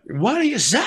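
half-strength mock crowd hype; frontman's catchphrase inflection. Trying out a song title, mock frontman call. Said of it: "that same inflection".

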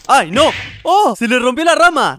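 loud startled outburst; shocked cry. Real, not acted. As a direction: react to the fall in alarm. Branch breaks, Tomás falls.

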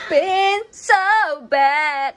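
loud, high mock wail; mock complaint. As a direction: ham up the bad day, loud. Loud complaint, then just kidding.

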